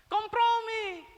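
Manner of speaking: Kompromi stretched slow, high and loud; preacher hammering the word Kompromi; drawn-out emphasis. Acted. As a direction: lean hard on Kompromi, slowly.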